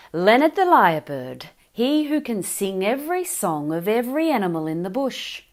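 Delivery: loud, high and boastful; a lyrebird bragging loudly; proud. Acted. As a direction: puff up and announce yourself. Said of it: "Leonard said proudly".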